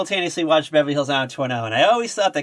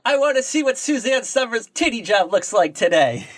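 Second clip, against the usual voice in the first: higher, eager mock clicker; mock eager reader; 7 semitones higher than usual. Mocking a clickbait reader to a friend, eager higher voice.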